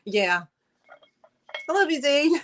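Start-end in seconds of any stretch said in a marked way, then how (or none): 1.7-2.4 high gushing declaration, laughing after. Real, not acted. Seller gushes to her friend Dee on the stream, high and giggly. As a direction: gush at her, then laugh.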